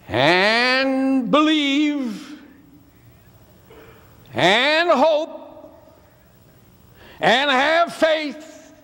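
slow, drawn-out phrases, each given its own weight; preacherly exhortation. Retold or imitated. Mother urges her slumping son to have faith, exhorting slowly. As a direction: stretch out each urging like a preacher.

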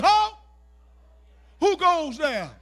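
loud, high guard's challenge; challenging sentry. Acted. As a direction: shout it like a guard stopping an intruder. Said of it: "the peace of God says".

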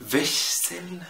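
فيشسل بشين خفيفة وبعدها إس; قول فيشسل بشين وإس ورا بعض; hard chs. Shown as a demonstration. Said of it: "تقيلة أوي". (نطق فيشسل بشين وإس)

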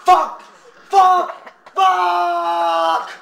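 repeated high, loud curses; rage shouting. Pretend. Gamer cursing at the game, rage shouting. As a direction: build to a long final yell.

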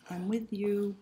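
plain reading voice

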